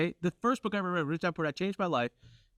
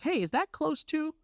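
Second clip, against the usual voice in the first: mock innocent question; 13 semitones higher, slower than usual. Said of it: "I'm like".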